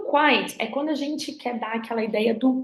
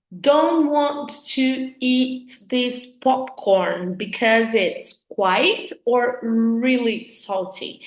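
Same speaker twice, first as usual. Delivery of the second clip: slow example read; much slower than usual. Shown as a demonstration. Teacher reads example sentence slowly.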